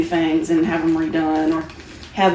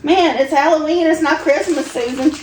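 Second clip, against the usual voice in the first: mock scolding; 8 semitones higher, 6 dB louder than usual. Playfully scolding about Christmas decorations.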